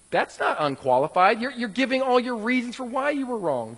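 ordinary talk, nothing marked

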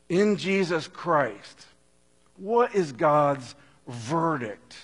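ordinary talk, nothing marked

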